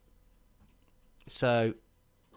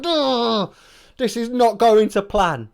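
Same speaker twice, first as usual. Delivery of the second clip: loud, high frustrated complaint; exasperated groan; 9 semitones higher, 9 dB louder, slower than usual. Retold or imitated. Groom fails to light sparklers, loud exasperated groan. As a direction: let the frustration burst out.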